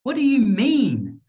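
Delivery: "what do you mean" with exaggerated high-pitched stress; word stress. Shown as a demonstration. Teacher demonstrating word stress, "what do you mean" strongly emphasised. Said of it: "this is like when you stress the words".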